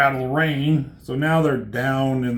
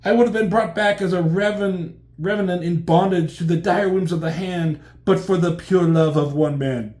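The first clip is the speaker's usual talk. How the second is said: raised, loud theatrical lament; grandiose tragic heroine; 5 semitones higher, 5 dB louder than usual. Acted. Elektra recalling the love that saved her, mock-tragic declamation. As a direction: declaim it as overblown romance.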